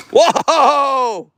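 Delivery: high, drawn-out whoa; exclaimed whoa of surprise; startled whoa. Real, not acted. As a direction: cry out whoa, pitch jumping up. Reacting to nephew riding.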